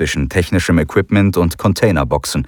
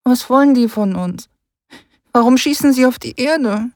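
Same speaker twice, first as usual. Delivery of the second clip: put-on high stammering child voice; a scared boy asking; small child voice, stuttering start; frightened boy. Rick child voice held.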